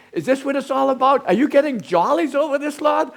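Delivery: high, loud anguished accusation, voice straining upward; Job's bitter cry to God. Acted. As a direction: hurl it at God, wounded and furious. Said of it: "he says".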